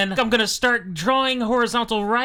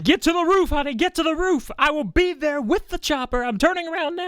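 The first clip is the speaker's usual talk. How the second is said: high, urgent calling out; urgent shout; 8 semitones higher than usual. Retold or imitated. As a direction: yell it like a rescue order. Rock shouting on phone.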